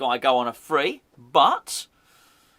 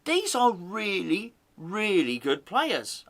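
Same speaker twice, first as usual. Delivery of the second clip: slow and emphatic; 3 semitones higher, slower than usual. Real, not acted.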